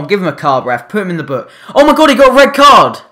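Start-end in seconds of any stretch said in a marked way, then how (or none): 1.7-3.0 loud, high, fast disbelief; shocked shout. Real, not acted. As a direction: blurt it out in shock. Shocked at opponent's red card.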